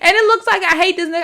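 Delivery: loud, high-pitched exasperation; heated exclamation. Real, not acted. Reviewer admitting how the rant looks to viewers, heated exclamation. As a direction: burst out, half protesting.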